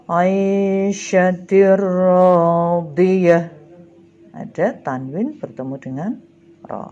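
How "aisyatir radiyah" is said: aisyatir radiyah loud and slow, long rolled r; merged reading. Shown as a demonstration. Teacher gives pupils an idgham example, merged recitation. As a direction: recite aisyatir radiyah drawn out, r doubled with no hum. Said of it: "cara membacanya adalah lebur tanpa dengung".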